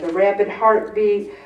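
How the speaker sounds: usual speaking voice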